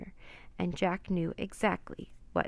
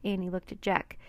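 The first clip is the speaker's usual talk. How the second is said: ordinary delivery, nothing marked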